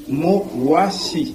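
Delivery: "Mo, wa, si" in three separate, measured syllables; syllable-by-syllable pronunciation. Shown as a demonstration. Teacher showing how the Lingala word is built, syllable by syllable. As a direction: sound out "Mo, wa, si", each syllable on its own. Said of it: "C'est ça la prononciation".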